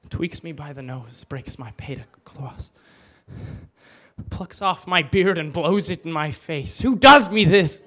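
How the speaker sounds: declaiming Shakespeare, swinging from hushed to loud outbursts; Hamlet soliloquy. Acted. Alone on stage, Hamlet berates himself, dramatic recitation. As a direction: rage and despair at himself, building to shouts.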